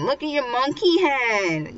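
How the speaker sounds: high playful jeer; mocking taunt. Guys make fun of Genta's hair, acted mocking taunt. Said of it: "guys are making fun of him".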